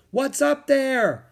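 high, slow, loud shout. A narrator's reading. Jack shouts up the cliff over the waves, shouting. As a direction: holler it up a cliff. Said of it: "Jack shouted".

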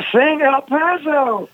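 put-on high, very slow slurred drunk request; drunk patron voice. Sleepy drunk waking to repeat his song request, slurred. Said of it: "He said".

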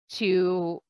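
usual speaking voice

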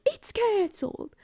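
high, put-on voice for a friend; admittedly inaccurate high impression of a friend; silly friend impression. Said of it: "Belle doesn't sound like that, ... only thing I have for impression".

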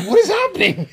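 high-pitched baffled cry, half laughing; mock bewilderment. Aunt lost at family dinner as everyone says wit, baffled. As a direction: squeal it in comic confusion.

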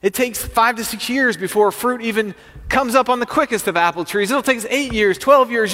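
high, heated protest; worked-up, fed-up rant; exasperated farmer. Acted. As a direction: argue it down and send the kid away. Exasperated farmer dismisses the boy.

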